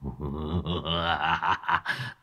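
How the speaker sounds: low, soft sinister chuckle; villain's evil laugh. Acted. Old sorcerer sneering at his trapped victim, evil laugh. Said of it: "Lão Cửu rít lên".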